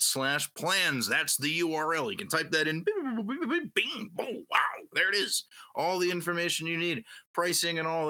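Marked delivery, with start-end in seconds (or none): 2.8-5.4 high sing-song bings; mock excitement. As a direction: chirp like a computer, then wow. Mimicking site clicks, excitement.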